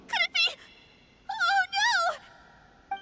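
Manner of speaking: high-pitched cry of dread; alarmed. Acted. Girl realises what is threatened, high and alarmed. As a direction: cry out in sudden panic.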